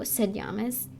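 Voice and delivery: ordinary delivery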